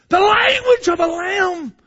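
high, loud, tear-choked proclamation; preacher weeping as he shouts; tearful shout. Real, not acted. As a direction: cry the phrase out. Preacher crying out theme.

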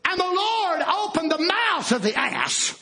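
high, ringing pulpit reading; proclaimed scripture. A narrator's reading. Preacher announcing the miracle to his congregation, proclaiming. Same verse proclaimed after "watch.

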